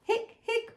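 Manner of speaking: very high, squeaky hics; hiccups. A narrator's reading. Hippo can't stop after fizzy pop, high hiccups. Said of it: "I'm hiccuping bubbles".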